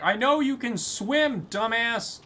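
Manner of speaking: high, slow exasperated shout; annoyed yell. Pretend. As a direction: yell it at someone faking. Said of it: "the distance is like".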